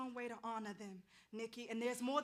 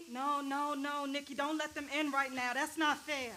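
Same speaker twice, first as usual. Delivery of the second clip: loud, high, urgent pleading; alarmed outcry; panicked protest; 4 semitones higher, 9 dB louder than usual. Acted. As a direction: stop them, begging loudly. Begging ghost not to summon.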